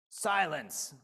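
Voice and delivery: loud sharp bark. Acted. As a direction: bark the order. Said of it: "barked back".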